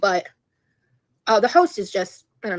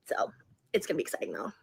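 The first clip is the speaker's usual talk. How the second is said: whispering; 5 semitones lower, 11 dB quieter than usual. Real, not acted. Teasing secret idea.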